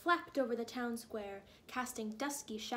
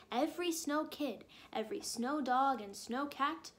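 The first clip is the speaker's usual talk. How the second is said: usual speaking voice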